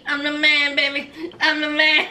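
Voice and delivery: put-on low, Borat-style accent, crooning baby; Borat impression. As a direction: ham it up like Borat, smug and pleased. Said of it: "He sounds just like Borat".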